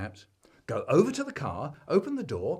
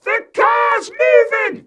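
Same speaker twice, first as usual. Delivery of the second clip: panicked scream; 15 dB louder than usual. A narrator's reading. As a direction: scream it in terror. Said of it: "He's screaming".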